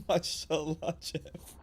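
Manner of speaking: very high squeaky laugh-voice. Real, not acted.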